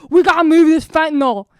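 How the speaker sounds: loud, hammed-up Delco accent; mock Delco accent. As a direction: belt it out as an overdone Philly-suburb accent. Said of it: "They're like".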